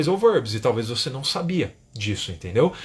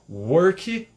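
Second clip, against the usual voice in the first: "work" as "uor-ki" in a Brazilian accent; Brazilian-accented two-syllable "work"; strong Portuguese accent; 3 semitones higher than usual. Shown as a demonstration. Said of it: "com um sotaque forte de português".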